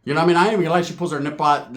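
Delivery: normal talking voice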